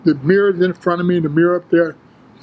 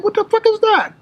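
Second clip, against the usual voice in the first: very high-pitched shocked outburst, retold; startled disbelief. Lifter seeing his bulging vein, startled disbelief. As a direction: imitate your own shock, voice shooting way up.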